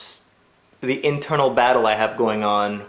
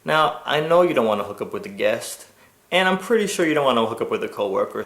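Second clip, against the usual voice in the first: usual speaking voice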